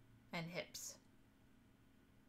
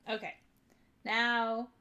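usual speaking voice

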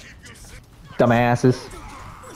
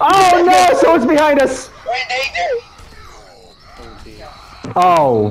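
high panicked yelps. Real, not acted. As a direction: panic as you're ambushed. Panic in fight.

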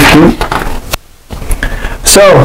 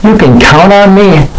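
high, cheery little plane voice; 8 semitones higher than usual. Acted. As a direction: pitch it up like an eager toy plane. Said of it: "said J J".

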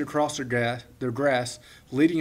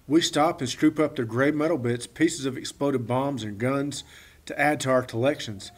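ordinary delivery

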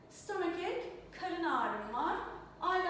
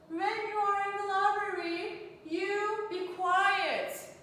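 higher, louder quiz-item read with a slow ending; reading the question; 6 semitones higher, 5 dB louder, slower than usual. Shown as a demonstration. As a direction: read the test sentence out to the class. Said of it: "Ne yapmalısın?". (öğrencilere soruyu yüksek sesle okuyan öğretmen)

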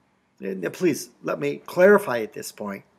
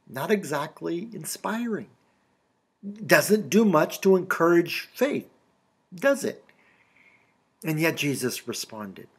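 ordinary talk, nothing marked

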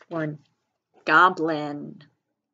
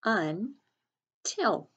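"Until" split as Un, long pause, til; slow, broken-up "Until" for early readers; syllable by syllable; 2 semitones higher than usual. Shown as a demonstration. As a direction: sound out "Until" in two separate syllables. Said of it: "sound it out by syllables".

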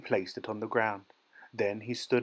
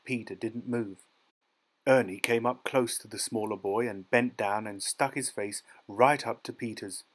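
ordinary delivery, nothing marked

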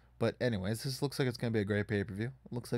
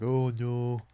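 mocking the company; 3 semitones higher, 7 dB louder than usual. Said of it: "here they're like".

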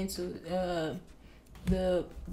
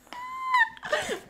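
high squealing giggle; delighted shrieking laugh; giddy laugh. Real, not acted. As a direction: let out a thrilled squeaky laugh. Giddy laugh after title.

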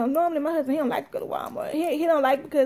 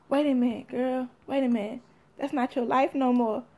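low, softly spoken gentle check; lightly voiced, quiet word of caution; Jesus; 3 semitones lower, 6 dB quieter than usual. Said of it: "y'all know Jesus, my best friend, he was like".

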